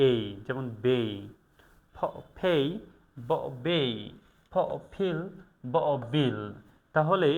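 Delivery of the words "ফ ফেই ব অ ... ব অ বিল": ফেই, বেই, ফিল, বিল each led by its bare sound ফ or ব; careful classroom model of ফিল and বিল; phoneme drill. Shown as a demonstration. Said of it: "এগুলোকে এভাবে উচ্চারণ করতে হয়".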